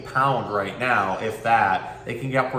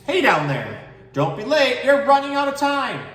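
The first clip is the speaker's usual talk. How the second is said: high, louder warning call; 13 semitones higher, 5 dB louder than usual. Acted. Bird calls down to little tortoise, high loud warning.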